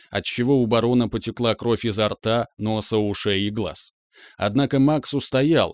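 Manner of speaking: ordinary delivery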